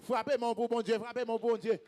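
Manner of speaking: loud, emphatic congregational preaching; rousing preacher's call; full-force preaching. Real, not acted. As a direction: drive each phrase out to the crowd.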